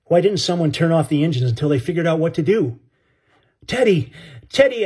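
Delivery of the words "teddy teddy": high urgent shout of a name; calling out high in panic. Acted. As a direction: shout his name, higher each time. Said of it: "I shouted".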